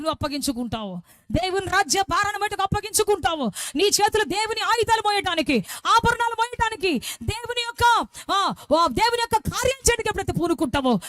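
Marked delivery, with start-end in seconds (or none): 1.3-11.0 rising sermon fervour; full-force preaching. Acted. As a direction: preach it out to the hall.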